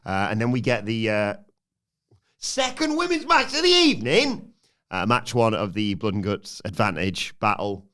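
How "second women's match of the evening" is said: high, loud ring-announcer call; big hammed-up announcer voice; mock ring announcer. Ring announcer impression in match recap.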